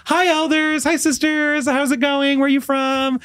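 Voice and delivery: very high, chirpy singsong hello; overly friendly greeting. Retold or imitated. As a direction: greet them with exaggerated sweetness. Acts own greeting to missionaries.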